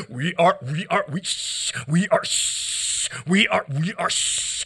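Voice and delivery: quiet stammered words cut by shushes; hushed stutter. Acted.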